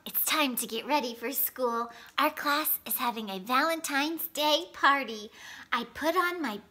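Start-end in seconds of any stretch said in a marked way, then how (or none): none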